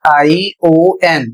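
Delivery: slow letter-by-letter spelling of I O N. Shown as a demonstration. Teacher spells the ending of nation, slowly. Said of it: "spelling है".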